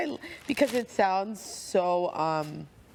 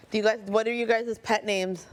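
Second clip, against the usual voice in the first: ordinary talk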